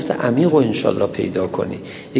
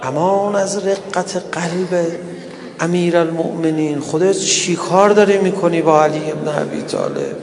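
higher-pitched, plaintive preacher's lament; mourning recital; 6 semitones higher than usual. Acted. Preacher mourns Fatima before the congregation, plaintive lament. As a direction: plead in grief, stretching the phrases.

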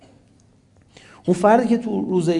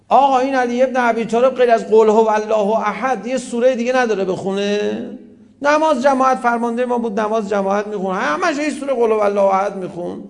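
mock indignant complaint; 6 semitones higher, 4 dB louder than usual. Complainers about Ali quoted.

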